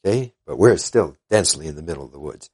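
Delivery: ordinary talk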